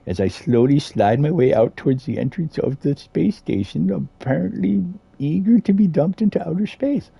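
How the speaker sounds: high-pitched, hammed-up narrator; mock-dramatic narration. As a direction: narrate your own doom in a high comic voice. Mock narrates his own glitching slide.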